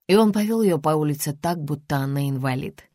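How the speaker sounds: usual speaking voice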